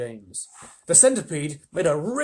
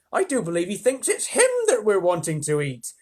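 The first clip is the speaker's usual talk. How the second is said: ladybird voice; 5 semitones higher, 5 dB louder than usual. Acted. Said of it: "the ladybird cried".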